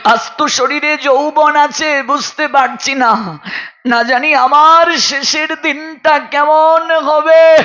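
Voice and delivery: high, wailing preacher's cadence; plaintive, high-pitched cry about mortality; impassioned lament. Acted. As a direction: lift it high and draw out the ending. Preacher laments coming old age and death.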